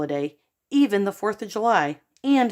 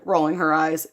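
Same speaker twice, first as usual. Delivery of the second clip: plain reading voice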